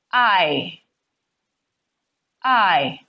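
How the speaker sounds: "I" drawn out as a lone vowel sound; vowel sound demo. Shown as a demonstration. Teacher to students, sounding out the vowel "I". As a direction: sound out "I" like a single open vowel. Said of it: "for example".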